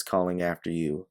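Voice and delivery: usual speaking voice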